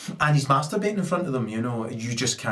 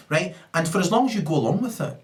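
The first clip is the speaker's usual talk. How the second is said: ordinary talk, nothing marked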